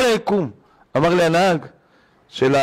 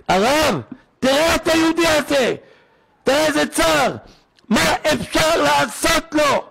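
anguished plea; 9 semitones higher than usual. Retold or imitated. Man begging the rabbi for a sufferer, high anguished plea. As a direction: plead with stretched, high-pitched words.

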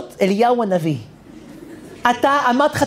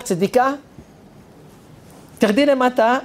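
ordinary talk

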